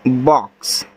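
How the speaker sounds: बॉक्स said clearly, with a short open vowel, as a model; model pronunciation. Shown as a demonstration. Teacher models बॉक्स for pupils, clear and careful. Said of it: "ऐसे".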